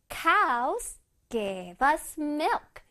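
very slow, each word set apart; slow model reading. Shown as a demonstration. Teacher showing the class the sentence again, slowly. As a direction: draw out each word, pausing between. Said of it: "다시 해볼게".

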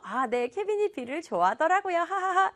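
playing along with a forced laugh; smooth it over, ending in a high fake laugh; covering up. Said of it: "크리스틴도 눈치를 채고". (맞장구치며 어색하게 웃어넘기기)

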